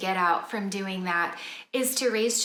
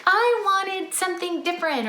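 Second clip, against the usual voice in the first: much higher, imitating a picky little kid; mock a child demanding another snack; a child's voice, lightly whiny; 10 semitones higher, 3 dB louder than usual. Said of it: "that child says".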